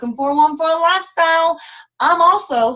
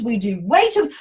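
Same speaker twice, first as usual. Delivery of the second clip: usual speaking voice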